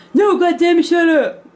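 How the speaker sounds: annoyed dad snap. Retold or imitated. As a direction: cut her off like a fed-up father. Dad snapping at kid.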